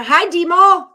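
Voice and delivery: high, loud cheerful hello; excited greeting. Real, not acted. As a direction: call out the name brightly. Greeting a chat viewer.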